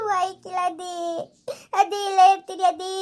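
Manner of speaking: put-on a small girl digging in her heels; little girl refusing. Daughter tells grandma she won't go, high child voice.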